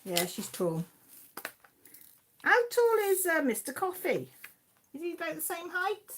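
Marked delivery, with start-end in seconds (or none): 2.4-6.1 higher and louder, eager; bubbling with curiosity; excited curiosity. Real, not acted. Host eagerly asking viewer about her husband, excited.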